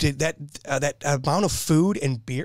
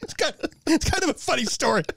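laughing; 12 semitones higher than usual. Real, not acted. Still laughing after punchline.

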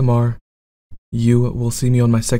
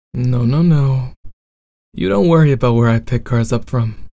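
bad boy roleplay.

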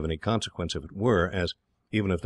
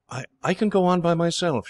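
put-on much higher and louder, flustered young girl; girl protesting; 11 semitones higher, 5 dB louder than usual. A narrator's reading. Girl struggles to get down from Dick's horse, high and flustered. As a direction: stammer it out, eager to prove herself. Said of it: "making a little struggle to get down".

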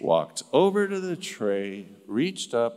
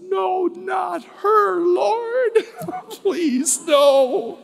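high, loud, drawn-out pleading cry to God; anguished plea; 7 dB louder, much slower than usual. Retold or imitated. Chaplain pleading with God about the visitor, high anguished cry. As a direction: beg the Lord in laughing despair. Retold prayer of dismay at wall.

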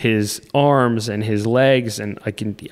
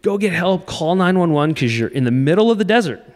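urgent yelling; 7 semitones higher than usual. Retold or imitated. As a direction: urge everyone to go get help. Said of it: "we're telling everybody".

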